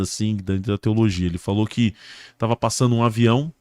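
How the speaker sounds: usual speaking voice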